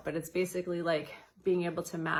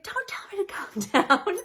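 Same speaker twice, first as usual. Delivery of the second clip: high and loud, half laughing; mock outraged shriek; 9 dB louder than usual. Mock outrage at being told to calm down, shrieked high. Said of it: "And you're like".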